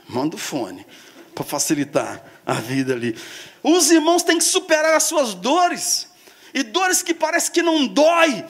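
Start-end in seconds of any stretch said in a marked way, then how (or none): 3.6-8.4 emphatic preaching. Acted.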